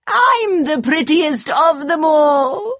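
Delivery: high, slow, gloating queen; evil queen. Acted. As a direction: crow it slowly up high, vain and wicked. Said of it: "cried the wicked queen".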